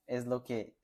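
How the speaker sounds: usual speaking voice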